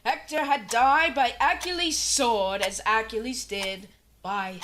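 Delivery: steady classroom lecture read; lecturing teacher. Acted. Teacher lecturing the class on Hector, formal lecture. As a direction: hold forth like a proud history teacher.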